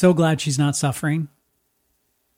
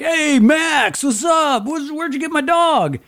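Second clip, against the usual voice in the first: much higher, louder mock neighbor voice; neighbor guy; 14 semitones higher, 6 dB louder, slower than usual. Neighbor surprised to see his dog, high mock voice. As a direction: play the clueless guy greeting the kid. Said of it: "he's like".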